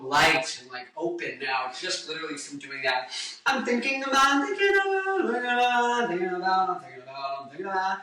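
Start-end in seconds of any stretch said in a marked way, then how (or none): 3.5-8.0 yawning sung drill, sliding higher; vocal exercise. Shown as a demonstration. Singer repeats his yawning warm-up drill. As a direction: yawn it out, articulating each syllable. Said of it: "I'm working on yawning and articulating".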